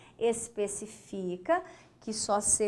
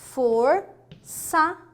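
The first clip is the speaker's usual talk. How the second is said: "reforçar" slow and louder, in separate syllables; 3 semitones higher, 6 dB louder, much slower than usual. Shown as a demonstration. Teacher isolating the verb for students, "reforçar" syllable by syllable. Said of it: "Ó".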